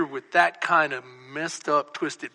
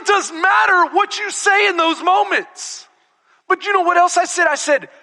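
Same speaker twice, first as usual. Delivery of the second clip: high, raised preacher's voice; full-force preaching; 14 semitones higher, 8 dB louder than usual. Real, not acted. Preacher asks congregation what they'd have said, preaching loudly. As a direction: call it out to the congregation.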